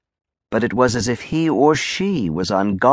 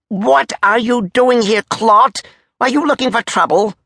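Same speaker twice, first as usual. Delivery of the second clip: high, menacing interrogation; angry challenge; 12 semitones higher, 3 dB louder than usual. Acted. Major asking if soldier wants trouble, high and angry. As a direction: challenge him in a high, heated voice.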